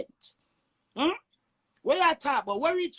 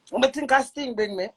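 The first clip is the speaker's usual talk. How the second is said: normal talking voice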